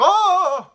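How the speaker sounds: high, very loud yelp of fright; mock scream as if bitten; startled yell. Man retelling a branch he mistook for biting geese, startled yell. Acts out scare at imagined bite.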